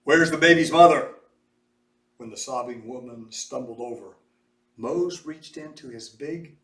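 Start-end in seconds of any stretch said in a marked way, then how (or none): 0.0-1.1 loud calling shout. A narrator's reading. Mose shouts to find the mother, loud call. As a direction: call out over the crowd.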